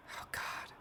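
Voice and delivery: whispered dismay. Real, not acted. Recoils at the light theme.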